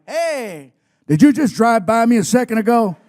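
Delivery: loud eager hail across the drive; calling out. Retold or imitated. Fan calling out to wrestler by his car, loud and eager. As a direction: shout a hello to get his attention.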